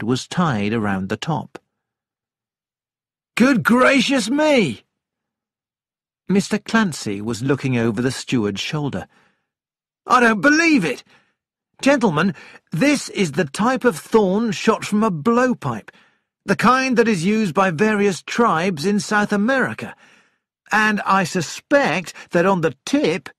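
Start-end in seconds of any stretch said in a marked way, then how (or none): 3.4-4.8 high, loud, drawn-out amazement; astonished exclamation. A narrator's reading. Onlooker sees the dart over the steward's shoulder, astonished. 10.1-23.3 high-pitched, amazed explainer; excited onlooker. A narrator's reading. Amazed passenger tells the gentlemen about the dart, high and excited.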